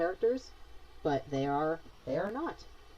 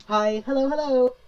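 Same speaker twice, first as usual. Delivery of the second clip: louder, higher sing-song hello; much louder, higher cheerful hello; excited greeting; 8 semitones higher, 9 dB louder than usual. Real, not acted. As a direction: greet someone warmly, bursting out.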